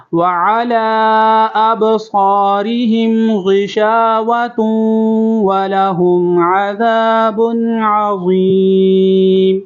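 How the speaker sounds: slow, high melodic recitation of ختم اللہ علی قلوبہم; model recitation. Shown as a demonstration. Teacher recites so students can listen carefully, slow melodic chant. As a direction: chant it slowly for students to copy. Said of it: "چلیں اب سنیں دوبارہ".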